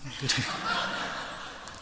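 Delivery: breathy quiet chuckle; little breathy laugh; soft laugh. Real, not acted. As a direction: laugh under the breath at the joke. Laugh after dialect joke.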